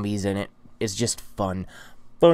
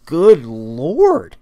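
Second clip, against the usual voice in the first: a louder, higher "Good Lord" aimed at himself; mock exasperation; 6 semitones higher, 9 dB louder than usual. Real, not acted. Exclaiming at his own babbling to viewers, in mock exasperation. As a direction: throw up your hands at yourself, loud.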